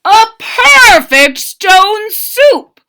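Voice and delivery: slow, high, loud proclamation; soldier voice. Acted. First soldier praising the soup, slow and high. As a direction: savour it slowly and loudly. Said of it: "said the first soldier".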